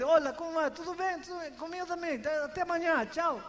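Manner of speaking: high, sing-song mock small talk; rote greeting. Pastor mimics friends trading hollow greetings.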